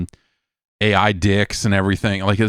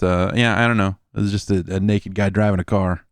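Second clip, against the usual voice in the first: ordinary delivery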